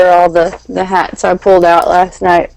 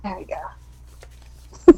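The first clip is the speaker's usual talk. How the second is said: whispering; 11 dB quieter, much slower than usual. Real, not acted. Whispered aside.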